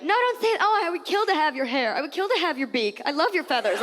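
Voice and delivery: high gushing compliment flood; mock reassuring friend. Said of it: "they never let you say it".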